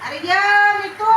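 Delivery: loud high call across the yard. Real, not acted. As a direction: call out to someone far off. Calling someone from afar.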